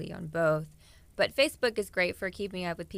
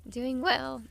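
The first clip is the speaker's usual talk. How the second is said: voice cracking; 7 semitones higher, 4 dB louder than usual. Real, not acted. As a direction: voice cracks on the words. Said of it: "My voice cracked".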